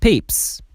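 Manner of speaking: "peeps" ending in a hissed s after p; voiceless s ending. Shown as a demonstration. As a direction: finish "peeps" on pure s, no buzz. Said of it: "S or E S is pronounced as s". («peeps» با س بدون لرزه)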